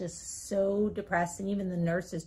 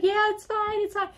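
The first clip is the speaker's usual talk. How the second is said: high, loud brush-off; hasty reassurance; 5 dB louder than usual. Retold or imitated. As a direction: call back that all is well. Laughing reassurance called to nurses.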